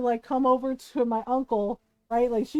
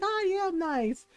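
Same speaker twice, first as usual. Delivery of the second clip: high, slow, indignant insistence; retold mad protest; 8 semitones higher, slower than usual. Cousin answers scolding aunt, mock-mad protest. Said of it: "he was so mad".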